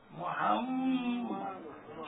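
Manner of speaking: মুহাম্মদ very slow, lips closed on a long m; lips-together pronunciation. Shown as a demonstration. Preacher demonstrates lips meeting in মুহাম্মদ, long held m. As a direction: press the lips and hum through the m of মুহাম্মদ. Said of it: "ঠোঁট লাগাই দিবে".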